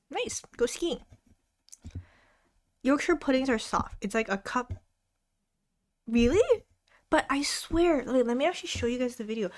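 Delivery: normal talking voice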